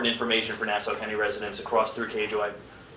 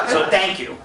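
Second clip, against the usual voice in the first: ordinary talk, nothing marked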